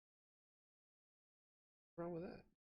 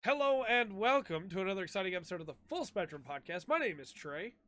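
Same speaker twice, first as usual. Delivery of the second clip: podcast intro; 5 semitones higher, 8 dB louder than usual. Acted. Podcast host opening the show.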